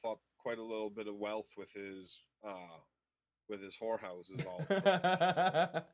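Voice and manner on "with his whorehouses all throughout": laughing. Real, not acted. Laughing about whorehouses.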